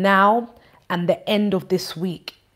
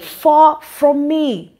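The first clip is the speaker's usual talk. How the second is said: slow emphatic preaching; 7 semitones higher, 7 dB louder, much slower than usual. Acted. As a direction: draw out far from me, loud and slow. Repeats verse ending, slow and loud.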